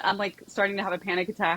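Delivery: usual speaking voice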